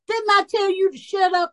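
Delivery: imitating a young mother hollering high; mock holler. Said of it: "she hollering at them".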